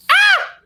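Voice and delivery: sudden high-pitched shriek; high scream. Retold or imitated. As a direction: let out a shrill yelp. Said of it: "Chris Clark screaming".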